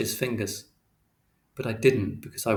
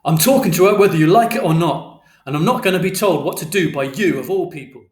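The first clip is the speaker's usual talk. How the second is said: loud, raised-pitch yelling; angry shout; 6 semitones higher, 11 dB louder than usual. A narrator's reading. Father bursting into the flat at night, shouting. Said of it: "And Father shouted".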